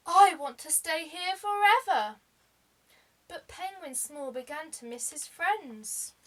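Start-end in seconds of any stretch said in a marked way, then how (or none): 0.1-2.2 delighted snowman. A narrator's reading. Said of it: "said the snowman".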